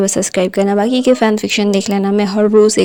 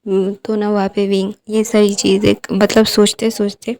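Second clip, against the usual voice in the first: plain reading voice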